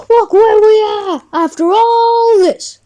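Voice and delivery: high whiny cry of despair; a kid whining in defeat. Acted. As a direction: wail it like all is lost. Sprinkles despairing over defeat.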